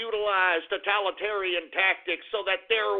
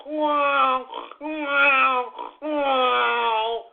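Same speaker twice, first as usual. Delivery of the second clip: higher, louder mock amazement; sarcastic wow; 5 semitones higher, 3 dB louder, much slower than usual. Host scoffing at Pokemon-obsessed public, sarcastic wows. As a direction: drip with contempt on each wow.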